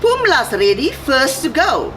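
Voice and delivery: lively, sing-song picture-book reading; reading to children. A narrator's reading. A commissioner reading a rhyming story to children, sing-song. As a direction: read the rhymes to little listeners.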